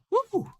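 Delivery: high whooping cheer; excited whoop. Real, not acted. Host to chat, all worked up, whooping. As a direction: let out a big excited woo.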